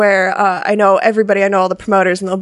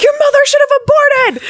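road rage yell. Retold or imitated. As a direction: scream it at the car ahead, half laughing. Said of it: "where I get the most laughs is my road rage".

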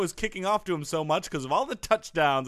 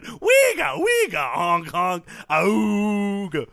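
silly clown; 3 dB louder, much slower than usual. Pretend. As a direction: honk out nonsense like a clown. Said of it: "I feel like you're making fun of me".

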